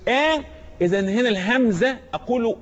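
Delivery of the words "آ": آ pitched high with a firm onset; high, fronted demonstration of آ; light, strong hamza. Shown as a demonstration. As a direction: start آ with a clean thin hamza. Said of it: "مرققة".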